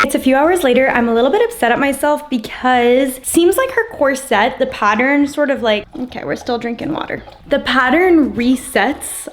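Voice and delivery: normal talking voice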